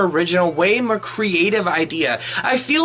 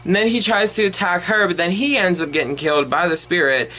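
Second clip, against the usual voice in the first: usual speaking voice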